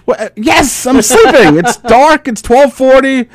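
high exasperated reply; dad annoyed at midnight. Retold or imitated. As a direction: spell out the obvious, fed up. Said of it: "And I'm like".

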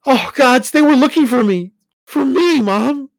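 high, loud fear; frightened realization. Acted. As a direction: it hits him they hunted him.

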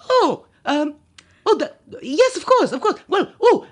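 scrambling to placate with anxious politeness; flustered British panel. Retold or imitated.

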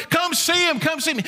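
high-pitched eager call; hollering. Retold or imitated. Woman calls her town to come see Jesus, hollering. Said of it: "she's hollering".

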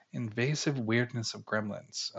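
mulling it over. Real, not acted. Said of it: "this is a double negative here".

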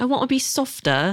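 high, exaggerated Australian accent; put-on Aussie accent. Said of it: "throw an accent on".

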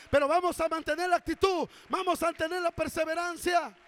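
loud, driving exhortation, lines pushed hard; rally the church with fervor; full-force preaching. Acted. Pastor rallying the Naucalpan congregation, loud fervent preaching.

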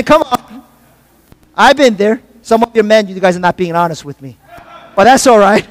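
normal talking voice

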